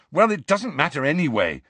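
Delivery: frustrated. A narrator's reading. Harry to his friends, frustrated about getting there. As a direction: let the frustration push through. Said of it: "said Harry frustratedly".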